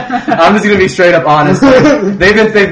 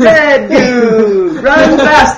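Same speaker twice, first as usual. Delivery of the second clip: excited; 9 semitones higher, 5 dB louder, much slower than usual. Pretend. Excited mock of game.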